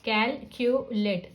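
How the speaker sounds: Calculate slowly in three chunks, cal-cu-late; syllable by syllable. Shown as a demonstration. Teacher explaining what syllables are, calculate slowly split in three. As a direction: separate each syllable of calculate. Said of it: "इनको syllable कहा जाता है".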